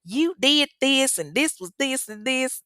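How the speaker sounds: Southern judge and attorney. Shown as a demonstration. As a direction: play a Southern attorney laying out the accusations. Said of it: "doing my judge and attorney".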